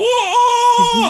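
long, high shouted whoa; loud, drawn-out cry of mock astonishment; freaking-out group reaction. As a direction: imitate a crowd losing it. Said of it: "freaking out".

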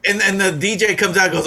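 usual speaking voice

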